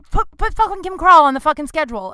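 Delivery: loud, high, exasperated mock command; retold boss's order. Owner ordering managers to schedule her, exasperated mock command.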